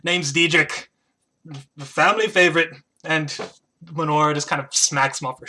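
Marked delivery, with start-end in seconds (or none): 0.0-2.7 brash squirrel introducing himself; squirrel character. Acted. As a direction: introduce yourself loudly as a cocky squirrel. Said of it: "the squirrel just goes".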